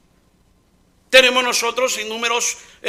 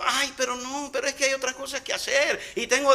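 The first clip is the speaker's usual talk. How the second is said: soft, reluctant whine; whiny excuse; 3 semitones higher, 8 dB quieter than usual. Acted. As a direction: brush off God with a lazy excuse. (excusa quejumbrosa y suave)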